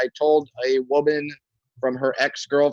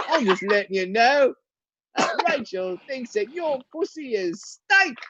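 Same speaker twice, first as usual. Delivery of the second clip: put-on British accent; 10 semitones higher than usual. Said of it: "I had to say it in a British accent".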